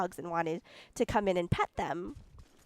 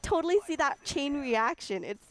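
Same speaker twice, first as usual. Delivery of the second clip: ordinary talk, nothing marked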